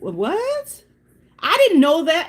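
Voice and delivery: high-pitched, astonished protest; acted disbelief. Driver learning of the curfew from officer, high acted disbelief. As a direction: jump way up as if stunned.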